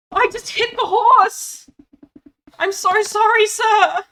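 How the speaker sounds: high, loud, horrified apology; mortified at hitting the horse; shocked gasp. Real, not acted. Accidentally hits horse.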